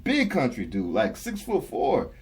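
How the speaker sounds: ordinary talk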